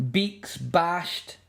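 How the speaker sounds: plain reading voice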